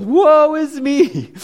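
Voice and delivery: high, slow, drawn-out wail of woe; a prophet crying out in dread before God; anguished prophet. Retold or imitated. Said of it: "He comes before God and he goes".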